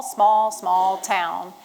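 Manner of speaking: slow, stretched small, small; lingering on small; drawn-out small. Acted. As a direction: stretch each small out slowly. Stressing how small the town is.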